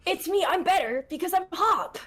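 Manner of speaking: high-pitched anime fuckboy voice; Hop. Shown as a demonstration. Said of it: "It's Hop's voice".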